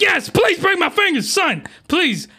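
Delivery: high eager voice; lightly hammed high plea; mock eager girl. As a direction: chirp it like agreeing too fast. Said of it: "And she's like".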